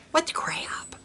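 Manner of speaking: whisper. Real, not acted. Muttered aside while reading date.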